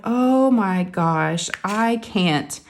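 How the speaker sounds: very slow, drawn-out dread; overwhelmed. Retold or imitated. Patient told no blood is coming, overwhelmed. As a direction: stretch out each word as if you can't take it.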